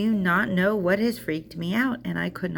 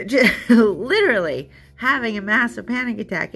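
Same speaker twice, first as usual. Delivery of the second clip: panicked; 5 semitones higher, 6 dB louder than usual. Retold or imitated. Acting out the panic attack.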